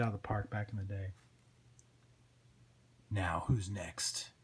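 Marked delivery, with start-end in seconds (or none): 3.1-4.3 slow, low, soft challenge; dark, deliberate antihero; menacing Spawn. Acted. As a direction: draw it out low and threatening.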